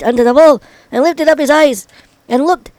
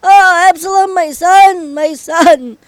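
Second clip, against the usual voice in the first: high, drawn-out grieving cry; mournful raised-voice wail; crying aloud in lament; 6 semitones higher, 3 dB louder, slower than usual. A narrator's reading. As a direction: cry out for a lost son, falling at the end. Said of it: "cried with a loud voice".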